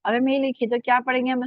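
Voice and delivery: normal talking voice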